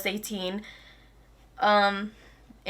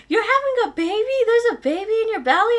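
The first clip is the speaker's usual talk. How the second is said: put-on much higher, excited little kid voice; little sisters; 12 semitones higher than usual. Little sisters barging into the room, excited kid voice. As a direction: squeal like a thrilled small child.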